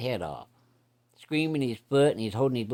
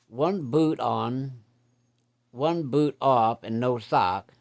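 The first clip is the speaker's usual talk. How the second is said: slow comic emphasis; 3 semitones higher, 3 dB louder, much slower than usual. Retold or imitated. Comic description of cousin.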